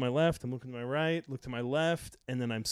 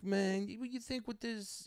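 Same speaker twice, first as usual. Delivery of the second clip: put-on whiny young heckler; 9 semitones higher, 4 dB quieter than usual. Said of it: "He goes".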